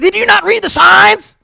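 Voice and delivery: very high, loud mock screech; mad homeowner. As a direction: imitate a furious woman yelling. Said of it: "She comes out mad as fuck".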